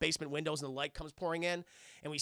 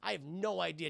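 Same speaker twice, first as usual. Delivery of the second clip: ordinary talk, nothing marked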